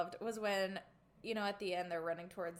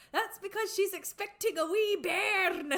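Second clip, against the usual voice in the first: put-on Jock's Scottish accent; 9 semitones higher, 6 dB louder than usual. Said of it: "And Jock goes".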